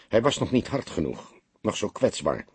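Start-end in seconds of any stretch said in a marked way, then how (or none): none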